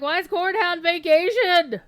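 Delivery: loud, high mocking imitation of viewers; mock complaint.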